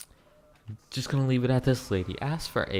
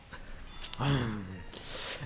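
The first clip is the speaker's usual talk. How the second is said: long, soft sigh after a rant; exasperated sigh; 9 dB quieter than usual. Real, not acted. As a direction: let out a weary breath of frustration.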